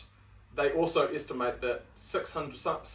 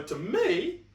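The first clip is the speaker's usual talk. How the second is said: voice going high; 10 semitones higher than usual. Real, not acted. Host getting excited making his point, voice going high. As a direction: pitch jumps up on "me", drawn out. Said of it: "my voice went all high there".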